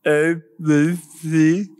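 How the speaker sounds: ABCs said while holding tongue; holding tongue with fingers. Shown as a demonstration. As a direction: say letters with tongue held. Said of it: "Like, so like this".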